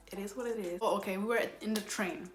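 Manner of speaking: normal talking voice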